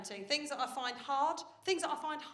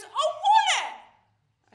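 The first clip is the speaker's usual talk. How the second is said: put-on much higher, louder, stretched toddler whine; toddler tantrum wail; 13 dB louder, much slower than usual. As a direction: wail it like a furious three-year-old.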